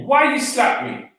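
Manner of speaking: high-pitched retold demand; indignant, high acted challenge; indignant question. Retold angry question after the slap.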